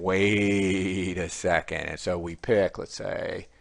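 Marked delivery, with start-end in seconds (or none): 0.0-1.9 slow, drawn-out wait a second; a stretched, thoughtful pause before doubting; slow realisation. Shown as a demonstration. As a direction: let the doubt dawn slowly. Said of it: "you gotta start saying".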